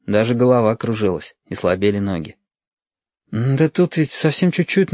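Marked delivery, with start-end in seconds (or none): 3.3-4.9 embarrassed. A narrator's reading. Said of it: "смущённо сказал Стасик".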